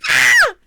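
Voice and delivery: loud high delighted shriek; excited scream. Real, not acted. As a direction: let out a thrilled scream. Reacting to track name.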